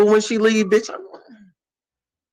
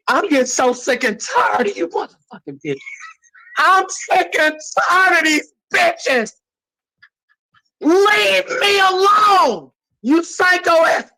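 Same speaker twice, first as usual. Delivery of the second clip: crying angry shout; 10 semitones higher, slower than usual. Real, not acted. Fed up with the girls in the group chat, a crying angry shout. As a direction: yell it through tears.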